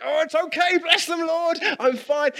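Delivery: a driver cut off in traffic, hammed-up strained calm; strained fake calm. Mock patience stuck in traffic.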